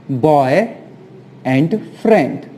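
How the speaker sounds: put-on Boy, pause, and friend, slowly; "Boy and friend" said as separate slow words; split apart. Shown as a demonstration. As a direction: pull boyfriend apart into two words. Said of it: "splits boyfriend into parts".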